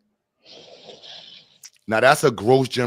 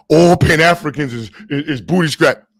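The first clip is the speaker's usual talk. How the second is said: loud, raised mocking voice; mocking FBA voice; 6 semitones higher, 10 dB louder than usual. Imitating FBAs dismissing Pan-Africans, loud mocking voice. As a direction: blurt it as a mock blanket put-down. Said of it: "F B As be like".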